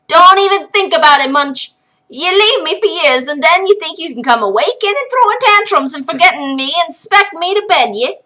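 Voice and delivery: high, loud scolding brogue; scolding old wife. Acted. Wife rebuffing her husband's advances, high scolding. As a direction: tell him off good.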